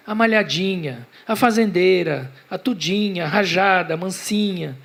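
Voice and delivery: mild mock cries, slightly lifted; strident cries. Boy naming the cows he recognises, restrained strident cries. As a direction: cry out each name, held within narration. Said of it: "Seus gritos eram estridentes".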